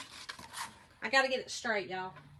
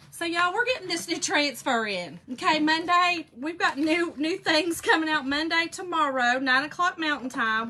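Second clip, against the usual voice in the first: louder, higher, upbeat promo pitch; excited announcement; 6 semitones higher, 8 dB louder than usual. Real, not acted. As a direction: sell it to the viewers with energy. Announcing new product launch.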